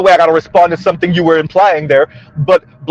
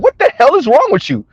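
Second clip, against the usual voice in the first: exasperated; 6 semitones higher than usual. Retold or imitated. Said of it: "wanna look at somebody and be like".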